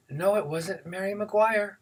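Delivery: put-on high, drawn-out boyish denial; denying teenage boy. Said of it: "And the boy said".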